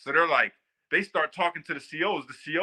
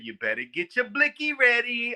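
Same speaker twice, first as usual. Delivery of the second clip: imitating a New York inmate; 8 semitones higher than usual. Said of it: "He said".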